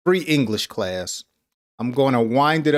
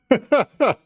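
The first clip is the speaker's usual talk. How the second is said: short laugh; 10 semitones higher, 4 dB louder than usual. Real, not acted. Laughs after hush example.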